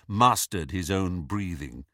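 ordinary delivery

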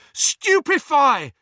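high, slow, loud spell cast; spell cry. Acted. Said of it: "he cried".

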